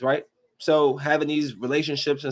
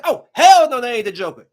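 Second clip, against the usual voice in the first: indignant outburst; 6 semitones higher, 8 dB louder than usual. Retold or imitated. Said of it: "I was like".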